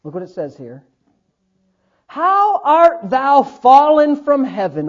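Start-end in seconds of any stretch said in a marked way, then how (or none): 2.1-4.9 slow, grand, drawn-out proclamation; declaimed scripture. Real, not acted. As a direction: proclaim the verse with ringing weight.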